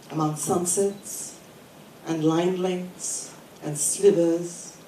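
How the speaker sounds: measured poetry recital with deliberate pauses; poem reading. Acted. Poet reading a poem about poems to an audience, measured recital. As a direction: read it in short phrases, pausing after each. Reads own poem aloud.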